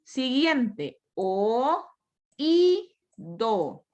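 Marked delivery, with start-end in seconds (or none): 1.1-3.9 "o, i, do" split into three separate beats; teacher's syllable drill, "o, i, do" pulled apart. Shown as a demonstration. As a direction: count out "o, i, do" one syllable at a time.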